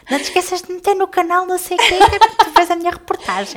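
high, fast excited reminder; excited nagging. Retold or imitated. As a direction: gush like plugging your own show. Mimicking friend's promo nagging.

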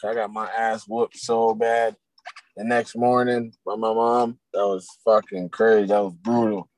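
usual speaking voice

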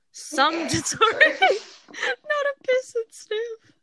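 high, slow, cracking up mid-read; trying not to laugh while reading; stifled giggles. Real, not acted. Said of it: "I was trying not to laugh".

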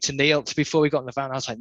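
ordinary delivery

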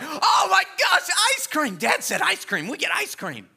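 high, loud excited shout; excited kids. Retold or imitated. Kids hearing ice cream, shrieking with excitement. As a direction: kids squealing at the treat. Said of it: "they're like".

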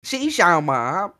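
slow drawn-out fake Chinese; hammed-up mock Chinese phrase; fake foreign language. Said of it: "You must learn the Chinese accent".

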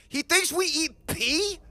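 high, drawn-out, disbelieving cry; loud incredulous goblin. Acted. Passing goblin turns round, loudly incredulous. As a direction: squawk the question in loud disbelief.

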